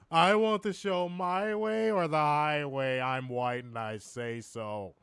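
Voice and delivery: lightly voiced pompous Werner impression, slow; a hint of a self-important cohost; mock Werner voice. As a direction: play Werner as a stubborn snob, drawn out. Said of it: "I'm Warner".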